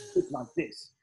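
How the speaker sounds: hammed-up British accent; exaggerated British. Shown as a demonstration. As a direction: lay the British accent on a bit thicker. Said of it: "up your Britishness even a bit more".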